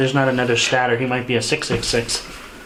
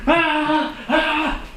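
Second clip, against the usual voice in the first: relieved; 13 semitones higher than usual. Real, not acted. Relief of sacrificing.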